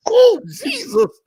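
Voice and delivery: loud slow laughing groan. Real, not acted. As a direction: laugh it out helplessly. Laughing fit.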